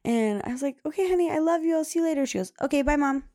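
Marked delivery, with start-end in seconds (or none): 0.9-2.2 higher, louder cheery goodbye; bright goodbye. Retold or imitated. Mom saying goodbye. 2.6-3.2 put-on higher, louder little girl's casual bye; imitating her daughter's higher, louder careless goodbye; breezy little girl.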